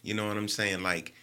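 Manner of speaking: normal talking voice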